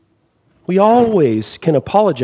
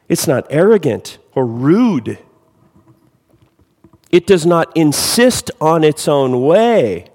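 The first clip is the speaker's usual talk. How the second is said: scripture reading; 3 semitones higher, 3 dB louder than usual. Acted. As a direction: read the love chapter with rising fervour. Reads 1 Corinthians 13.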